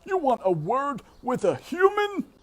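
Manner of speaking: very high, loud squeaky disbelief; a guard mouse scoffing in a high shout; shrill-pitched guard mouse, loud and amazed; incredulous hooded mouse. A narrator's reading.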